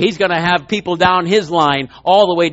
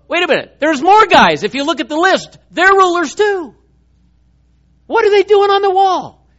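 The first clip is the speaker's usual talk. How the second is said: much higher, building astonishment; surprised realization; 11 semitones higher, 3 dB louder than usual. Real, not acted. Preacher to congregation, spotting more rulers, higher with astonishment. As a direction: discover it with rising amazement.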